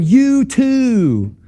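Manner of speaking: high-pitched mock holler; replayed car-window yell; shouted retort.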